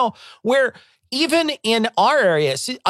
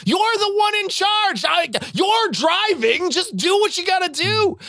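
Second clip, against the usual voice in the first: much higher, half-shouted exasperation; exasperated outburst; 11 semitones higher than usual. Retold or imitated. Patient snapping at careful ER staff, exasperated high shout. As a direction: throw up your hands and yell it out, higher.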